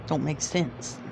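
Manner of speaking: ordinary talk, nothing marked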